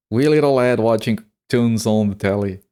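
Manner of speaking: exaggerated British accent; silly British accent, playing it up for chat; British idiot impression. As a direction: redo the line as a daft Brit. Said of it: "That's my one British idiot impression".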